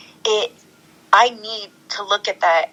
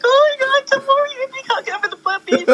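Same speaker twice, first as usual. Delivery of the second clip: put-on much higher, squealing baby talk; gushing at puppies; faster than usual. On set after cut, calling the puppies over, high squealing gush.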